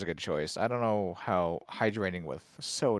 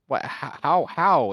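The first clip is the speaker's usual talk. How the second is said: incredulous; 4 semitones higher, 7 dB louder than usual. Real, not acted. Baffled that Sprite helps sickness.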